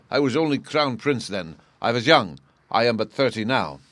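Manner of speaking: agitated nobleman defending himself; king excusing himself. Acted. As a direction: plead youth as an excuse, emphatically.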